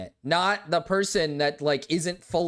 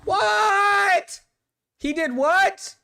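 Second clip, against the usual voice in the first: high, loud incredulous yelp; real stunned outburst, much higher and louder; shocked disbelief; 6 dB louder, much slower than usual. Real, not acted. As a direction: react like you can't believe what you just heard. Shocked by what the clip said.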